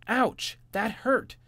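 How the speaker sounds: high, loud yelp of pain; high, loud pained little bunny; shouted ouch. Acted. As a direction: cry out as the book hits your head. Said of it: "shouted Jimmy".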